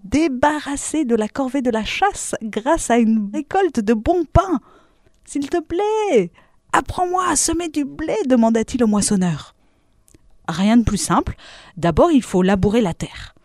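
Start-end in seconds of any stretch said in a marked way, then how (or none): 5.2-8.2 wheedling high-pitched request; eager pleading. A narrator's reading. Hopeful wolf begs the harvester.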